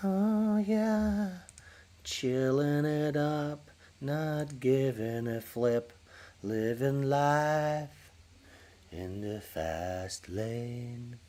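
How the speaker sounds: slow, drawn-out laid-back dude voice; put-on mic check voice. Streamer testing his mic, slow and lazy dude voice. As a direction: stretch it out like a lazy cool guy.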